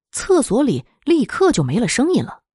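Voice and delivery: ordinary delivery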